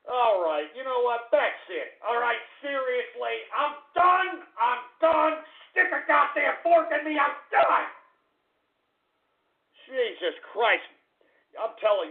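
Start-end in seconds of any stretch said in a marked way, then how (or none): 3.6-7.9 high, exasperated outburst; fed up. Real, not acted. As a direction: throw in the towel angrily. Fed up, threatening to quit.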